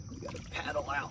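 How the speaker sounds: soft unvoiced whisper. Real, not acted. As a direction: whisper it while paddling. Paddling, recorded at a distance.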